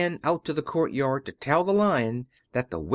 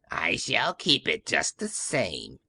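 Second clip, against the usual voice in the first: mocking witch; 6 semitones lower than usual. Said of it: "said the witch, laughing at her".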